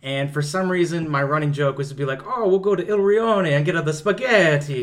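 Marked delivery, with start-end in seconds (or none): 2.2-4.8 bad Italian accent. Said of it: "just do a really bad Italian accent".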